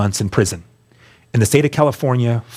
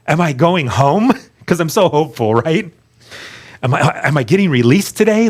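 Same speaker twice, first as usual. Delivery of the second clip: hopeful; 5 semitones higher than usual. Retold or imitated. Hopeful inner thought in court.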